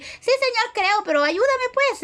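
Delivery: high, breezy "Sí, Señor" tossed off without weight; mock a careless, casual prayer; offhand plea. Preacher mimics a believer praying lightly, breezy and offhand. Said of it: "no estamos hablando de un decir".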